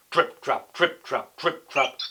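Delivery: loud, heavy stomping chant; booming big-goat footsteps. A narrator's reading. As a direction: stamp out each word like a big goat. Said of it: "he's much louder because he's bigger".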